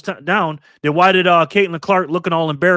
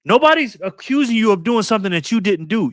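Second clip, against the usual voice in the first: normal talking voice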